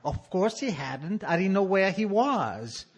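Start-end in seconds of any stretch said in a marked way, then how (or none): none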